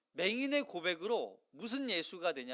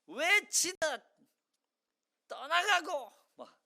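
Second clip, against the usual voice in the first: mock loud prayer; 9 semitones higher, 4 dB louder, slower than usual. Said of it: "통성 기도하듯".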